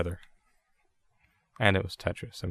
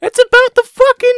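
loud, very high screeched protest; exasperated shriek; 11 dB louder than usual. Real, not acted. Host arguing about Thanksgiving's meaning, shrieking in exasperation. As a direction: yell it way up in pitch.

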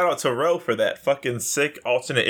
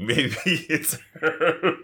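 laughing; 4 semitones higher, much slower than usual. Real, not acted. Laughing at the joke.

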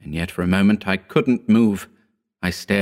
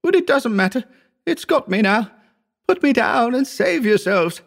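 very high, anguished plea; sobbing. A narrator's reading. Injured Wells begging his friends to leave him, sobbing. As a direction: plead through acted sobs, pitched far up. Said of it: "Wells sobbed".

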